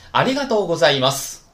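fast ありがとうございます with its tune. Shown as a demonstration. Teacher repeats the correct tune loudly. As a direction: snap out ありがとうございます with the accent. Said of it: "再来一遍".

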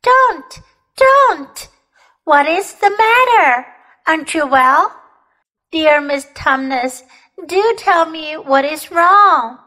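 high, loud, pleading distress easing slightly; great distress. A narrator's reading. Lucy begs the weeping faun to explain, in great distress. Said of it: "said Lucy in great distress".

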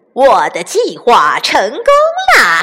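loud, slow, gloating villain; weird-voiced bandit chief. A narrator's reading. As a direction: crow slowly over your evil plan. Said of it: "怪声笑道".

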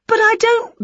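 very high Winnie voice, cut off; high protest. A narrator's reading. Winnie begins objecting to auntie, high squeaky protest. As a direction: squeak an objection before being interrupted.

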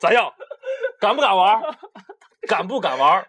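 laughing taunt; laughing dare. Real, not acted. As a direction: dare him through laughter. Daring friend laughing.

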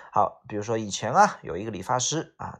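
ordinary talk, nothing marked

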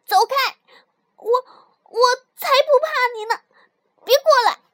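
high, loud, stammering defiance; frightened yelling. A narrator's reading. Scared mouse warding off tiger, defiant yelling. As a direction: shout the threat away, pretending not to be afraid. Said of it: "我冲他叫起来".

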